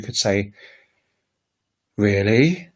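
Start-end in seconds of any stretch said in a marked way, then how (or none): 2.0-2.7 Really? with a rising, surprised tune. Shown as a demonstration. Teacher showing a surprised English Really?. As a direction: ask Really? as if amazed. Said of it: "really surprised".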